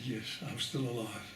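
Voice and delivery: quiet, muted answer; reply softly, under the weight of it; subdued reply. Retold or imitated. Subdued retold reply to the greeting.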